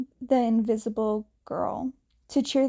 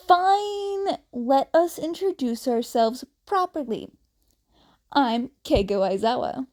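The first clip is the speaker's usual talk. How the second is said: proud; 3 semitones higher, 4 dB louder than usual. A narrator's reading. Twin proudly introduces himself to Bakugo. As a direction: puff up with pride. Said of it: "sounding really proud".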